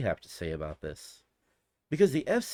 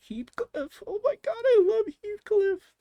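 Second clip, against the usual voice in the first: high-pitched fan squeal of delight; excited squeal; 5 dB louder than usual. Real, not acted. Delighted to spot a childhood favorite.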